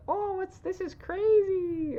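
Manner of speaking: very high mock little-kid voice; four-year-olds. Co-host imitating how four-year-olds might react to danger, childlike. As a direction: squeak it slowly like an amazed small child. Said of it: "they would probably be like".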